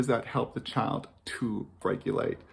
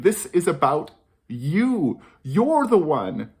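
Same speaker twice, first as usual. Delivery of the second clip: louder, slower mock voice of the school system; mock school message; 3 semitones higher, 5 dB louder, slower than usual. Parenting coach voicing schools blaming the child, mock emphatic. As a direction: hammer the blame home, slowly and loudly. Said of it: "what we're saying is we're saying".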